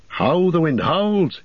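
high, slow alarmed cry; cried out. A narrator's reading. Aragorn leaping up, warning the company, crying out. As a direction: call the alarm, dragging each word. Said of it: "he cried".

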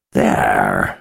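low mumble around a mouthful; mumbled through food. Acted. Wizard to Chase with his mouth full, low mumble. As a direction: talk through a bite of biscuit. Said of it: "he mumbled around the mouthful".

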